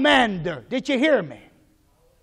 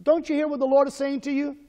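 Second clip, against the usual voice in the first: ordinary talk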